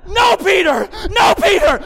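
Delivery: loud, high-pitched panicked shout; acted alarm. Disciples see Peter start sinking.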